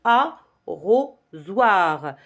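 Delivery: ARROSOIR in three beats, a... rro... soir; slow arrosoir with gaps: a, rro, soir; syllable by syllable. Shown as a demonstration. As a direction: separate each syllable of arrosoir. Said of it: "le mot se compose de trois syllabes".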